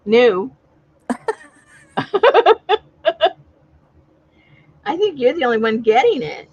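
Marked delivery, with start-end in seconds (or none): 2.0-2.8 short high, loud burst of laughter; a quick high laugh, louder than talk. Real, not acted. Laughing at the missing picture.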